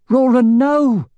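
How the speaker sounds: high, loud, drawn-out cry; shout. A narrator's reading. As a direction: scream his name to stop him, slowly. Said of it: "shouted Katrina".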